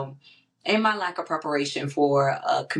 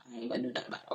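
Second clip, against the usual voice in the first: much softer, lower private grumble; muttered aside; 4 semitones lower, 11 dB quieter, much faster than usual. Retold or imitated. Privately refusing to deal with uncle, muttered aside. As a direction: drop it under your breath. Retold reluctant aside to herself.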